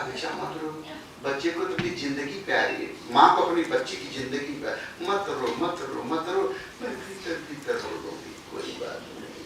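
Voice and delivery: put-on soft, hushed plea to a crying baby; tender hushing. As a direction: hush the child gently, almost whispering. Mother hushing baby not to cry.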